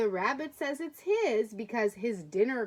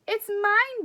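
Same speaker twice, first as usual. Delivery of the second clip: buzzing bee; 10 semitones higher, 9 dB louder than usual. Acted. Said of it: "buzzed the honeybee".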